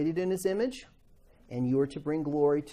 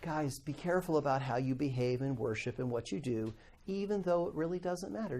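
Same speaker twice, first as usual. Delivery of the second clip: ordinary talk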